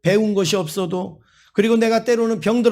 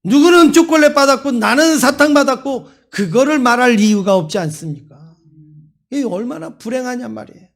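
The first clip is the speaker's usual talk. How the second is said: preaching at full force; 3 semitones higher, 5 dB louder than usual. Acted. Pastor urging his congregation to be grateful, in full-force preaching. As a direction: press every line on the congregation. Preacher drives home the lesson.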